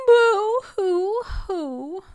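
slow, high hammed-up boo-hoo sobs; mock crying. Reader voicing sad Moose crying at book's end, hammed-up sobbing. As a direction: pretend to blubber like a sad cartoon moose. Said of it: "Is he crying?".

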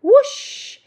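high, loud swooping whoosh; magical whoosh. A narrator's reading. Witch waving her cape, whooshing. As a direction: swoop the word up and out. Cape waved over the brew.

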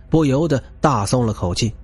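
plain reading voice

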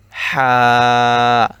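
loud হা breathed from mid throat. Shown as a demonstration. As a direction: push হা out from the throat. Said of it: "হলকের মধ্যখান হইতে".

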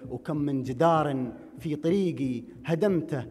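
declaimed Gulf Arabic poem, measured and rhythmic; projected verse with drawn rhyme endings; poetry recitation. Acted. Recites his poem to audience.

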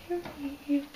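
soft, high call to a pet; coaxing pet voice. Real, not acted. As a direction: coax the bird over gently, up high. Calling the bird over.

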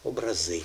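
"образи" ending on a stressed ЗИ; stress on last syllable. Shown as a demonstration. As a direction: land softly but firmly on ЗИ in "образи". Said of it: "Останній на останній склад".

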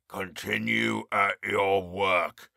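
low, slow croak from behind the door; croaking harsh voice. A narrator's reading. Jailer orders the prisoner through the door, slow low croak. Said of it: "croaking and harsh".

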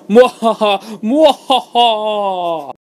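loud, high evil laugh, slowing down. Acted. Console declares war on the DS, evil laugh. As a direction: cackle like a cartoon villain.

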